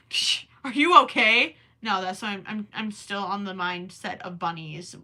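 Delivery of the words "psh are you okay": louder, playful are you okay with a rise on you; mock concerned. Shown as a demonstration. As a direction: tease the question up high. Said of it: "repeats question differently".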